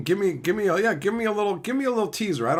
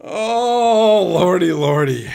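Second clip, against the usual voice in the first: big, drawn-out loud wail falling in pitch; loud exclamation; 10 dB louder, slower than usual. Real, not acted. Streamer recovering from own joke, loud exclamation. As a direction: let it out loud after laughing. Exclaiming after a laughing fit.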